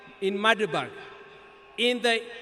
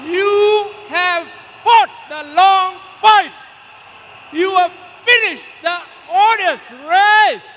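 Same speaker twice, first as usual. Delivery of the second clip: impassioned farewell oration; 6 semitones higher, 9 dB louder than usual. Real, not acted. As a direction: proclaim each phrase at full force, pausing between. Eulogy ends in shouted farewell.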